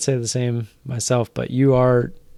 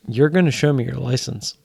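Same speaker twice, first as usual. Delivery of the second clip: usual speaking voice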